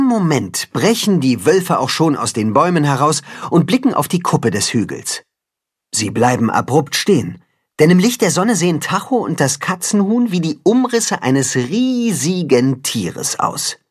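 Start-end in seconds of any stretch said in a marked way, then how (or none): none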